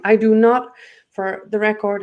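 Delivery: normal talking voice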